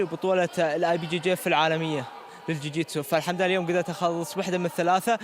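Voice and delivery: ordinary delivery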